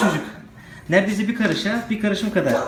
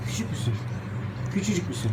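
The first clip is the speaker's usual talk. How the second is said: softer cooing question to a tiny pup; 3 semitones lower, 7 dB quieter than usual. Real, not acted. Owner coos softly to the tiny puppy.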